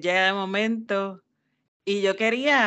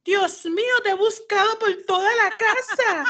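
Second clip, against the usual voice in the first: hammed-up high wail from a melodramatic girl; dramatic daughter; 13 semitones higher, slower than usual. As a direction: overdo it like a theatrical daughter. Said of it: "bien exagerada, bien dramática".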